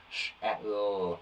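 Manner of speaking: sh, eh, l said slowly and separately; sounding out. Shown as a demonstration. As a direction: split shell into its three sounds.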